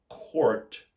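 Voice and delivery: court, the American way with the R sounded; General American court. Shown as a demonstration. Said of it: "in the general American accent, you would say for C O U R T".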